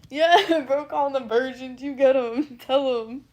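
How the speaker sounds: shouted hype between laughs; real, laughing shout of support; loud egging-on. Real, not acted. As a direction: cheer them on at full volume. Cheers on a chatter, laughing.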